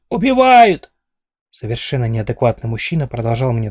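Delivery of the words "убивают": high, loud strangled scream; much higher and louder panicked cry. Acted. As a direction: shriek for help while choked. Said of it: "попыталась завопить я".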